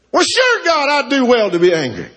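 very high-pitched mock outburst, falling at the end; indignant, angry retort. Jonah answers God back, acted indignant anger. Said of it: "dost thou well to be angry".

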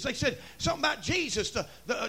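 ordinary talk, nothing marked

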